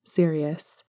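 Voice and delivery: ordinary delivery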